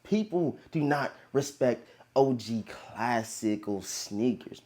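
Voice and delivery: ordinary talk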